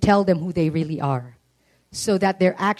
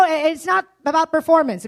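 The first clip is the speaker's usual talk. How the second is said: ordinary talk, nothing marked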